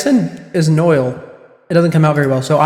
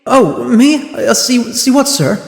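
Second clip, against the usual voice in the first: flustered juror; 7 semitones higher, slower than usual. Acted. Startled juror being addressed.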